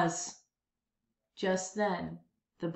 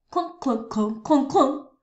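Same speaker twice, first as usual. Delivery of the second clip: louder with each strum; clunk clunk; 4 semitones higher, 6 dB louder than usual. A narrator's reading. Narrator mimics the boy's ukulele, rising strums. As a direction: plunk it out like a ukulele.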